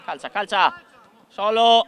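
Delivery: high urgent yell at players; shouting. Real, not acted.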